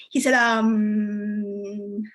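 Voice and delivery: very slow, long drawn-out ums and uhs; stalling. Acted. Comedian botching her best joke for him, stalling. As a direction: drag out each filler as if the punchline is lost. Pretends to forget her punchline.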